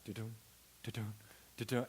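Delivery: soft, low thumping do-dum, do-dum; quiet rhythmic thud noises; bumping tire sound. Retold or imitated. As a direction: mimic a flat tire thumping along. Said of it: "the whole truck is doing this".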